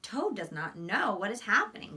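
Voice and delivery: ordinary talk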